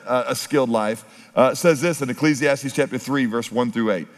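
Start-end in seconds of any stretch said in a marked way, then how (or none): none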